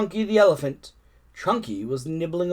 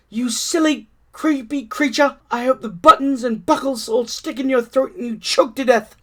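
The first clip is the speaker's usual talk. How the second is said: angry monkey; 9 semitones higher, 5 dB louder than usual. Acted. Monkey voice in story.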